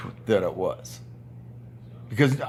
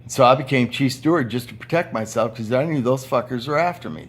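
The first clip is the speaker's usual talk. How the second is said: ordinary delivery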